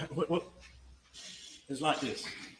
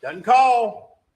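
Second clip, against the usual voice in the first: sharp raised voice, high and loud; loud accusation; 8 semitones higher, 14 dB louder than usual. Acted. Lawyer to jury, stressing a missed call, loud. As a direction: hammer it home to the jury. Closing argument, pointed accusation.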